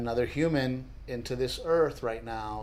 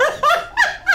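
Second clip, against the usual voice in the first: high, loud burst of laughter; high shriek laugh; 7 dB louder than usual. Real, not acted. Husband cracking up over the liked comment, loud high laugh.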